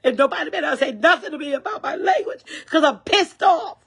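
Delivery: high, heated ranting with bursts of laughter; really mad, half laughing as she vents; angry rant. Real, not acted. Angry senior venting to her live viewers about stolen food money. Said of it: "I'm just that mad".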